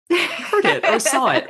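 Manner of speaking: laughing high through the words. Real, not acted. Writer laughing while explaining her editing. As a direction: giggle out the words as you say them.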